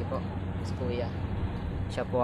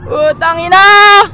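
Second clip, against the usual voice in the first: long whoop, then a yelled curse; full-voiced thrill-ride yell; real ride scream; 6 semitones higher, slower than usual. Real, not acted.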